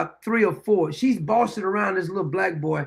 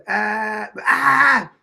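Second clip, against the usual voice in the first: mock baby cry; 4 dB louder than usual. Imitating crying along with a baby.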